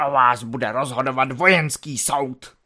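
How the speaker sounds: growled. Acted. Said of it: "Proto také zabručel".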